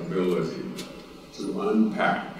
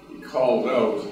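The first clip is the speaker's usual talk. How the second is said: ordinary talk, nothing marked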